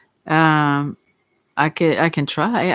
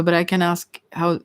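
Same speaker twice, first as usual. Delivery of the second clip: ordinary talk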